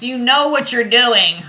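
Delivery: loud, high, drawn-out exclamation; exclaimed yell. Acted. Card reader acting out the oracle's guidance, yelling. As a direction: belt out the guidance, slowly and high, amused. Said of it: "She's yelling at you".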